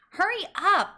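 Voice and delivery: high, pushy hurry-up; impatient urging. A narrator's reading. Julie tugging Ian's arm, impatient. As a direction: hurry him along, impatient.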